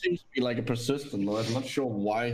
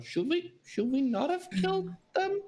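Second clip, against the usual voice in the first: much higher, hesitant character voice; timid question. Acted. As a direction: ask it meekly, voice way up. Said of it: "but just say to her".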